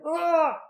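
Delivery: high, loud pained cry like a cartoon; loud cartoonish shriek of agony; tortured yell. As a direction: mimic a man howling over a stuck bolt. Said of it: "almost like he was being tortured".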